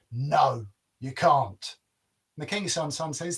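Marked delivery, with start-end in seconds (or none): 0.1-1.8 giant's emphatic no, after brooding; blunt storybook refusal; firm refusal. A narrator's reading. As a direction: refuse him firmly as the giant.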